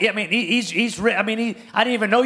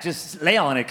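usual speaking voice

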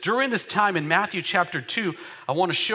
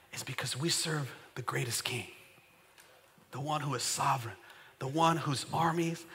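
much softer, intimate preacher's voice; soft earnest preaching; 4 semitones lower, 6 dB quieter than usual. Acted. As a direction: bring it down close and sincere. Soft intimate preaching after climax.

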